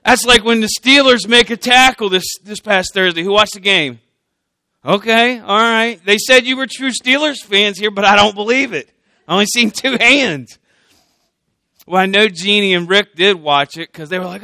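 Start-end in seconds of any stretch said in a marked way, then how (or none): none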